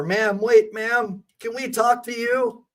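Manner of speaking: lightly voiced cop yelling after her; mock cop yell. Cops yelling at the mother to wait, mimicked lightly. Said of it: "they're yelling at her".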